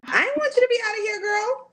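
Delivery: very high, quick, squeaky mock whine; playful whine. Real, not acted. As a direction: squeal it affectionately, almost crying and laughing. Friend reacts to near-death story.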